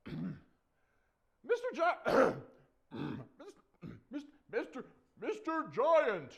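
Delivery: high squeaky call with false starts; timid little David. Acted. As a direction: clear your throat and pipe up high to a giant. Little David hails the giant.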